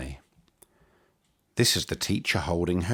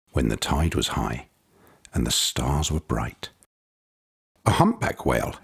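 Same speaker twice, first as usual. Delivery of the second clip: usual speaking voice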